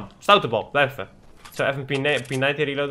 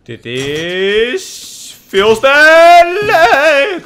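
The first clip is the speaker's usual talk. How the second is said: delighted; 10 semitones higher, 9 dB louder, much slower than usual. Real, not acted. Delighted while gaming.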